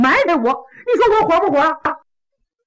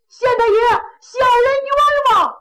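much higher and louder, crying out for justice; ringing loud plea; 11 semitones higher, 4 dB louder than usual. Acted. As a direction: belt it up to the magistrate. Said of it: "这大个子嗓门儿挺亮". (大个子高声喊冤，嗓门洪亮)

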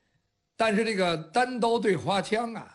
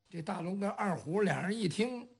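ordinary delivery

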